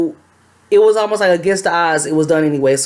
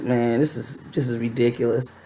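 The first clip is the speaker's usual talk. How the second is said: softer, lower grumble of disbelief; muttered frustration; 7 semitones lower, 8 dB quieter than usual. Retold or imitated. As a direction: mutter it under your breath. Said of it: "I was like".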